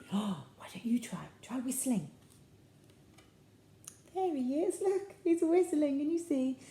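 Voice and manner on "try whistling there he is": usual speaking voice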